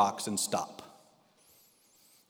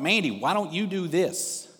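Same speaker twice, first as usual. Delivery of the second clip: retold yell; 6 semitones higher than usual. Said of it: "I yell up to the front".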